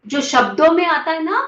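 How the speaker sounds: ordinary talk, nothing marked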